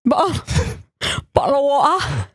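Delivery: playful mock British accent, laughing; hammed-up British accent. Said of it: "I love the accent".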